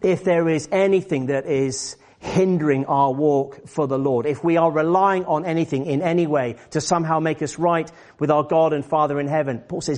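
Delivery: usual speaking voice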